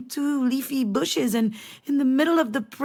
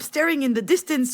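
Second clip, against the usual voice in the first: normal talking voice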